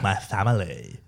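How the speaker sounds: lightly camp impression of him; mock his way of saying it; teasing imitation of friend. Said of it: "See the way he did it?".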